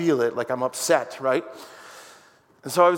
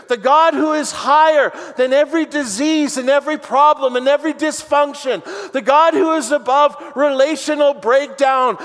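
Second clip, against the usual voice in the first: building to high, ringing proclamation; fervent pulpit crescendo; impassioned preaching; 6 semitones higher, 4 dB louder than usual. Real, not acted. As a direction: preach it in rolling, rising phrases. Builds into full-force preaching.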